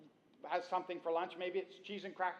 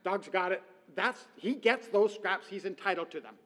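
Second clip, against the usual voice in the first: higher, louder possessive dog voice; voicing the dog; 5 semitones higher, 9 dB louder than usual. Preacher voicing a dog guarding dropped food, smug and possessive. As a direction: claim the floor as your territory. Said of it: "the dog is like".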